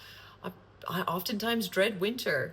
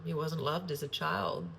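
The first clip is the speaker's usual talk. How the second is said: ordinary talk, nothing marked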